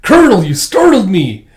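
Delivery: high, loud yelp of alarm; acted startled shout; startled scream. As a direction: cry out as if given a sudden fright. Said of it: "lets out a scream".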